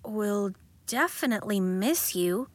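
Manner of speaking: plain reading voice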